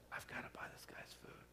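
hushed whisper to his wife; a secret whispered across the table. Retold or imitated. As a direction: lean in and whisper it. Telling wife quietly in restaurant.

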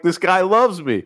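high, giddy dog voice; Will Ferrell's dog voice. Retold or imitated. Will Ferrell's dog gushing about fetch, high giddy voice. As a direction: bounce it up high like a happy dog. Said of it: "he's like".

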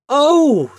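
very high, loud "oh"; delighted cry. A narrator's reading. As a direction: exclaim like children spotting a prize. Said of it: "they cried".

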